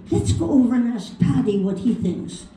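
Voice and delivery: put-on lilting Irish accent. Irishmen deciding to ask Paddy, Irish accent. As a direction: make a suggestion in an Irish accent. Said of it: "this is over in Ireland".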